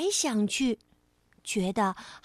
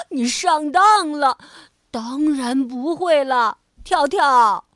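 triumphant; 8 dB louder, slower than usual. Acted. Rabbit gloating at his trick.

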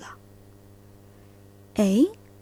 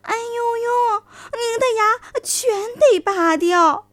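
fox exclaiming; 10 semitones higher, 4 dB louder, slower than usual. Acted. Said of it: "就叫了起来".

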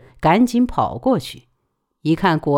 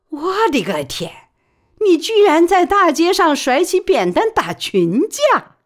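high and louder exclamation; aghast astonishment; 12 semitones higher, 4 dB louder than usual. Acted. Wan Xiaojing exclaiming at Baoli's street fight, high and aghast. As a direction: hand on heart, astonished.

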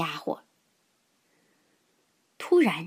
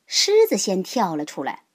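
ordinary delivery

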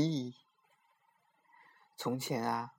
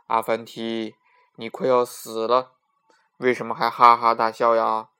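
louder, wondering king; puzzled king; 7 dB louder than usual. Acted. King to condemned Afanti, loudly puzzled. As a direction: ask it baffled and a bit loud.

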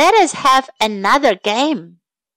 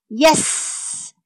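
breathy whispered snake hiss; 5 dB quieter than usual. Acted. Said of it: "hissed Snake".

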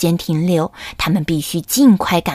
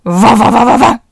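loud imitated dog barks; barking dog; 7 dB louder than usual. Fierce dog barks at the chicks. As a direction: bark like a fierce guard dog.

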